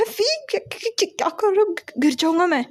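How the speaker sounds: high, fast panicked cry of surprise; flustered high yelp, rushing the words; startled yell. A narrator's reading. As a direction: yelp it out as he's suddenly lifted up. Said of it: "चिल्ला कर कहता है".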